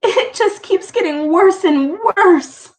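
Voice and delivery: high, tearful complaint; mother despairing over her daughter, high and tearful. A narrator's reading. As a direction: sound close to sobbing. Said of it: "She began to sob".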